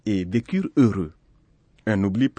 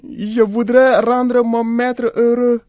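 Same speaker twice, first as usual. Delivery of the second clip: high, loud, slow goat voice; drawn-out, high-pitched animal character; little goat; 12 semitones higher, 6 dB louder, slower than usual. A narrator's reading. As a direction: plead like an eager little goat. Narrator voices the goat pleading.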